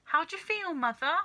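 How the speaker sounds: high, worried question; anxious question. Acted. Daughter asking her shrunken mother anxiously, high. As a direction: ask fearfully. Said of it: "asked Missus Cranky anxiously".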